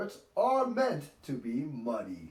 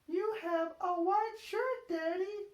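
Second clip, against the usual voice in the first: high little-pig voice, cheerful; smiling Peppa. A narrator's reading. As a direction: smile as Peppa helps Daddy. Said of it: "smiles Peppa".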